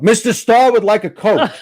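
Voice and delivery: high, loud announcement to the whole crew; calling out an order. Retold or imitated. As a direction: bellow it out so every assistant hears. Director calls out order to crew.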